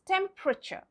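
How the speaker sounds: TEMPERATURE as tem-pruh-cher; three syllables in TEMPERATURE, tem-pruh-cher; silent E. Shown as a demonstration. Teacher sums up the rule, TEMPERATURE as tem-pruh-cher. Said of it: "The E sound of E is not there".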